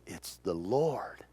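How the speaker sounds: awed whisper. Acted. Peter recognizes Jesus in awe.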